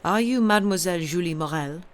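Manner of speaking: put-on loud Italian-accented stranger; strong Italian accent. A narrator's reading. Stranger stopping a girl on the stairs, strong Italian accent. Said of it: "in a strong Italian accent".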